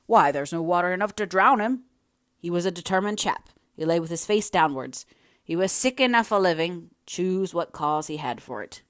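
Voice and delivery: blunt workman speaking up; a hint of a rough villager; lightly voiced village workman. Villager argues over drowned man.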